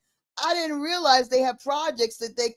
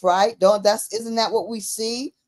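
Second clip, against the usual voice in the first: ordinary talk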